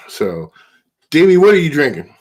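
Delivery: usual speaking voice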